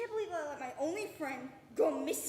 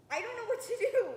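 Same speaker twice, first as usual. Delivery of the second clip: higher, louder, breaking despair; desperate cry; 8 semitones higher, 6 dB louder than usual. Acted. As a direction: plead it, at the end of your rope. Despair peaks.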